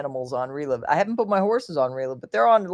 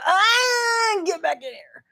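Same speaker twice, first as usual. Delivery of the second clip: mock yell; slower than usual. Owner re-enacts calling her trotting dog back, mock yell. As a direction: imitate a panicked call after a dog.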